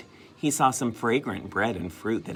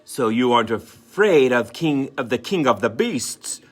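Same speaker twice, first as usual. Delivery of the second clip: louder mock-growly donkey; 3 semitones higher, 8 dB louder than usual. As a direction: try to sound like a fierce lion. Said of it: "in a growly voice".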